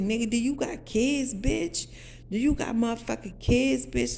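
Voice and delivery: retold incredulous challenge; indignant confrontation. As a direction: press them for an answer, outraged. Retold angry confrontation of the man.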